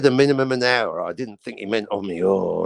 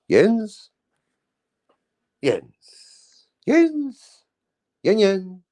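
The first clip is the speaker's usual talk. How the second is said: calling out; 9 semitones higher, much slower than usual. Real, not acted. Host calling for a missing co-host on air. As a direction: call his name up high, again and again.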